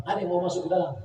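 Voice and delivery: usual speaking voice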